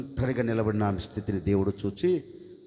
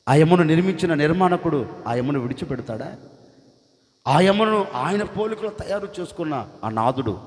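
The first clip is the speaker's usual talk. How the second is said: full-force preaching; 7 dB louder than usual. Acted. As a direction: drive it home to the congregation.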